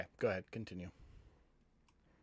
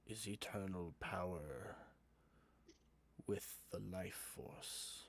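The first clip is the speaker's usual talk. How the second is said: sinister possessed voice. Acted. Possessed George tempts the party with eternal power, sinister voice. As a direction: make a hushed, ominous offer, phrase by phrase. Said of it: "that voice comes out of it again".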